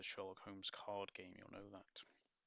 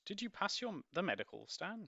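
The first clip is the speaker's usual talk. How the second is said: anxious sister; 11 semitones higher, 5 dB louder than usual. Acted.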